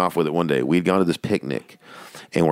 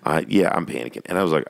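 normal talking voice

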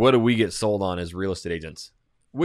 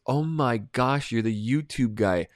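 excited fan; 4 semitones higher, slower than usual. Retold or imitated. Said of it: "She goes".

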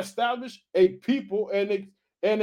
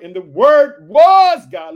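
preaching climax; 7 semitones higher, 14 dB louder, much slower than usual. Real, not acted. Climax of quote.

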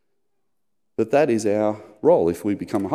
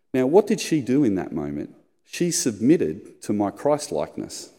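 normal talking voice

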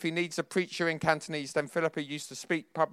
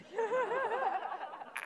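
short, high burst of laughter; amused laugh; 9 dB quieter than usual. Real, not acted. Preacher laughing at his memory to the congregation, amused laugh. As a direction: laugh at your own younger self. Laughs at own recalled thought.

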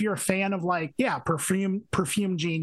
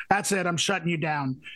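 usual speaking voice

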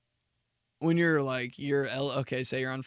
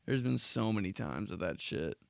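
normal talking voice

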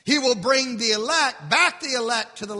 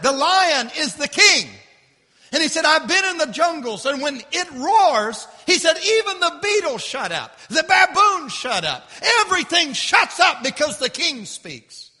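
raised, higher preacher's voice, punching key words; full-force preaching; 7 semitones higher, 6 dB louder than usual. Acted. Preacher retelling the lion in the jungle to the congregation, forceful.

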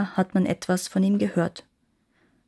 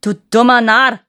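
much louder, gnome voice, full scream; 2 semitones higher, 10 dB louder than usual. A narrator's reading. Gnome to the cowering king, screaming an insult. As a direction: spit the insult at the trembling king. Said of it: "schrie der Gnom ihn an".

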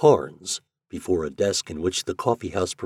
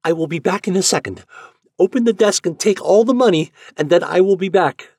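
put-on much higher, louder, fast urging girl; hurried Yuli; 12 semitones higher, 7 dB louder than usual. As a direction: rush the orders out, pleading. Yuli rushes off, urging him.

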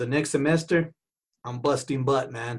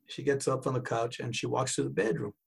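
ordinary talk

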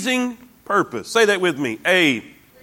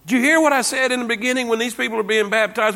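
usual speaking voice

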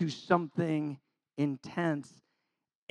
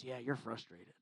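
much softer, sly agreement; sly soft devil; 8 semitones lower, 18 dB quieter than usual. Retold or imitated. The devil softly egging on an angry churchgoer, sly. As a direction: agree slyly, barely voiced.

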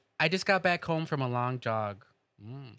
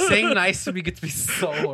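laughing; 4 semitones higher, 5 dB louder than usual. Real, not acted. Laughing while joking about horniness.

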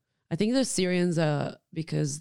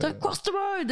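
mock angry driver; 13 semitones higher than usual.

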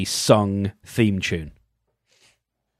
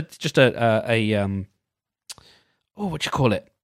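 ordinary talk

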